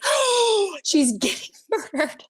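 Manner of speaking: high wail dropping into laughter; hammed-up horror that cracks into a laugh; mock horror. Acts out terror overhearing next room.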